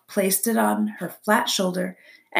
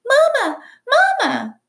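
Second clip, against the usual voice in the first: put-on tiny high-pitched baby bird cry; peeping gosling; 8 dB louder than usual. A narrator's reading. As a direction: peep loudly like a hungry hatchling. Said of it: "peeped the gosling".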